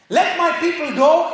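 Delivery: a decree shouted out; loud commanding declaration. Shown as a demonstration. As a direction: declare it with authority. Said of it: "is a commanding voice".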